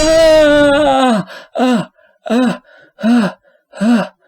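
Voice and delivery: high pained cries of ah; sharp anguished wails; agonized cries. Acted. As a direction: cry out in sudden pain. Screaming in agony after attack.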